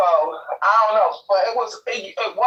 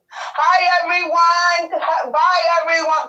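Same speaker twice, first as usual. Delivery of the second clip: shouted greeting; 9 semitones higher, slower than usual. Real, not acted. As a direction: call out hi to the whole live chat. Sister calls out to viewers.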